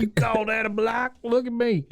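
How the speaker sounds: a high, far football coach bark; playfully hammed-up coach calling the block. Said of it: "dressed like a football coach".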